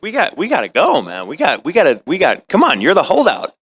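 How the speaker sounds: acted-out urging, stop-start and exasperated; impatient director. Director pressing the actor to finish the session, impatient.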